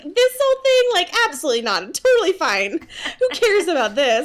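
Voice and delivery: high, laughing brush-off; hammed-up denial through laughter; mock dismissive. Bella brushing off injury, imitated.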